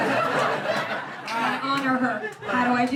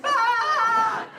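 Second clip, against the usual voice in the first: mock yelp; 8 semitones higher, 3 dB louder than usual. Motorboat pressure joke.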